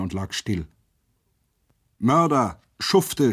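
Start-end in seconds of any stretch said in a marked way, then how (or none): 2.0-3.3 despairing cry. A narrator's reading. Crowd screams at the soldiers after the boy is shot, helpless outrage. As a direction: hurl the insults at the killers. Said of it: "schrien alle ohnmächtig und verzweifelt".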